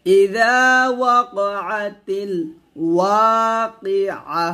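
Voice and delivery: ওয়াকিআ with a clear ain, no ya; slow ওয়াকিআ with ain at the end; correct pronunciation demo. Shown as a demonstration. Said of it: "আমরা এখানে যে ভুলটা করি". (ওয়াকিআ আইন দিয়ে)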